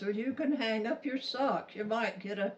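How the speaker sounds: usual speaking voice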